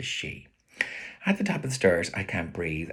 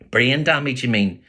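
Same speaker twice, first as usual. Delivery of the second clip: put-on cheeky boy voice; 5 semitones higher, 8 dB louder than usual.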